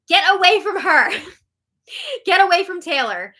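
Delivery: high, loud protective outburst; indignant warning. Real, not acted. Host warning Paloma away from Taylor, indignant. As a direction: call it out loud, warding someone off.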